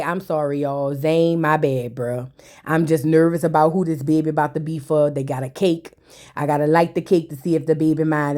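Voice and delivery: low, soft and sheepish, a touch jittery; nervous guy saying sorry to his boys before the cake reveal, voiced low and soft; nervous apology. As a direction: mumble it like you're scared of the answer. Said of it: "Now he nervous".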